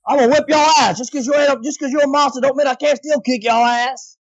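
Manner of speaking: Joe Baker. Retold or imitated. Joe Baker threatening a monster, tough New Orleans voice. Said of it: "you hear that in Joe Baker".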